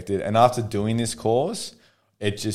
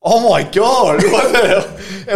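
slow, high, loud, laughing disbelief; dumbfounded by the pregnancy news; husband hears his wife is pregnant, slow, high, laughing disbelief; 1 stunned; 12 semitones higher, 10 dB louder than usual. Retold or imitated. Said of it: "I was like".

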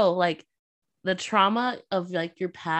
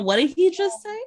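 high, louder, stunned quote; mock shock; 11 semitones higher, 4 dB louder than usual. Imitating their reaction to the book's line, in mock shock. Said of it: "It's like".

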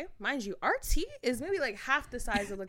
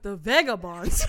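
loud, slow questioning echo; baffled echo; 2 semitones lower, 7 dB louder than usual. Retold or imitated. Confused passenger questions the stranger, baffled echo. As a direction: repeat it loudly in drawn-out disbelief.

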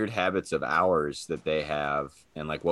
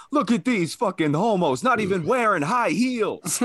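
mocking tough guy; 12 semitones higher, 4 dB louder than usual. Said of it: "they're like".

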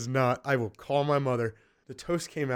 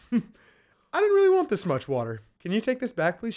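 higher, louder imitation of a picky diner; fussy customer; 8 semitones higher, 4 dB louder than usual. Mom's friend sending water back, mock fussy complaint. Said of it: "and she would go".

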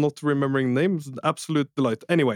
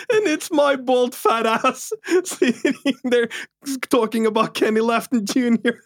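laughing; 10 semitones higher than usual. Real, not acted. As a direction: giggle through the picture. Imagining himself on ESPN, laughing.

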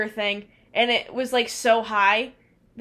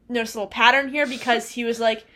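normal talking voice

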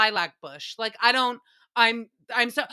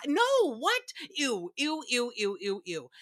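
disgusted. Real, not acted. Disgusted at neighbor's compliment.

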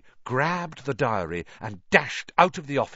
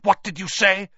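angry demand; 7 semitones higher, 7 dB louder than usual. Acted. Malfoy angrily demands an answer.

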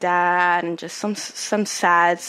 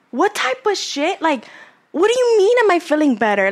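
incredulous outrage; 8 semitones higher than usual. Real, not acted. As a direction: sound stunned and offended at once. Outraged at ex's gaslighting line.

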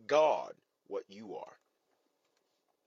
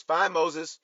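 fed-up Lord; 6 semitones higher, 8 dB louder than usual. Acted. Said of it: "The Lord says to Moses".

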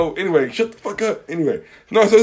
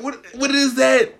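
baffled; 7 semitones higher, slower than usual. Retold or imitated. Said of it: "I'm like".

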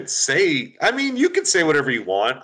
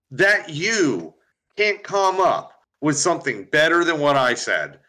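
normal talking voice

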